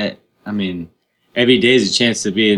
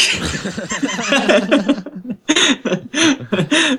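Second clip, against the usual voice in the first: high laugh burst. Real, not acted. Big laugh.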